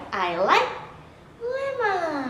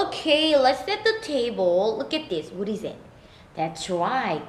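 ordinary talk, nothing marked

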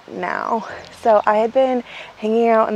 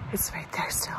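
whispering; 3 semitones lower, 13 dB quieter than usual. Real, not acted. Bear nearby, whispering.

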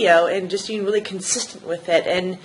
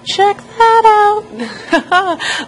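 excited; 10 semitones higher, 5 dB louder, much slower than usual. Real, not acted. Showing peppers growing.

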